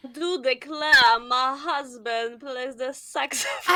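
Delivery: slow mock Southern belle accent. Friend demonstrating Hillary as a Southern belle, slow. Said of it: "cette fille qui vient du Sud".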